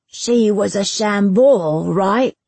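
slow, leading question; oblivious probing. Acted. Bailiff asking Destin about his mother, obliviously probing. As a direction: probe emphatically, tossing on a tag question.

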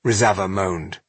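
plain reading voice

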